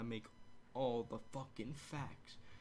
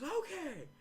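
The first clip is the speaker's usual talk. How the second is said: very high, louder mock shout; imitated referee; 5 dB louder than usual. Ref answering Paul Heyman, high mock shout. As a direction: squeal the agreement as a hammed-up ref. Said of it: "And the ref goes".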